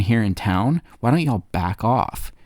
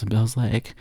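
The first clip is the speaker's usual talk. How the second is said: ordinary talk, nothing marked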